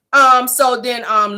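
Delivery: ordinary delivery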